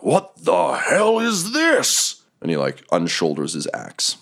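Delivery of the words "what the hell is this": high, slow, put-on bugbear bark; a startled brute demanding answers; gruff bugbear. Bugbear storming into the room, slow high bark. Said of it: "One of them's like".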